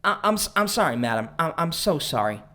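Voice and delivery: stammered, frightened apology; trembling apology. Acted. Cornered man apologizes to madam, trembling. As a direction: stumble over a nervous apology. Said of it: "He trembled".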